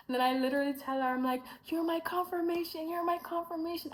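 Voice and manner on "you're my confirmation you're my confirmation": much higher, bursting with excitement; excited gush. Retold or imitated. Telling the Texan girl she is the sign, excited gush. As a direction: blurt it out twice, overjoyed.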